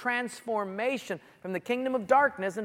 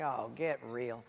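low, slow brush-off; deep, deadpan scoff; dismissive; 10 semitones lower, 8 dB quieter, slower than usual. Acted. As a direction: drop the voice, unimpressed. His scornful reply to society.

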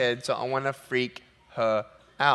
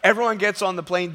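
usual speaking voice

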